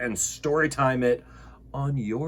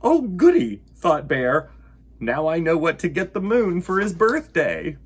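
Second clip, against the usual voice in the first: delighted; 4 semitones higher, 6 dB louder than usual. Acted. Bear delighted with idea.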